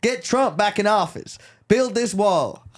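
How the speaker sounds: hammed-up Southern accent, riled up. Shown as a demonstration. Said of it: "I'm trying to impersonate".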